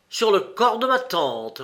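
loud, high, indignant answer; irate. A narrator's reading. Egyptian answering about his pledge, irate. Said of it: "Tout en se courrouçant".

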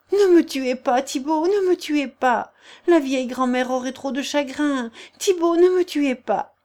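high, loud, desperate pleading; begging for her life. Acted. Agnelette on her knees begs Thibault not to kill her. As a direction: beg on your knees. Se mettant à genoux et joignant les mains.

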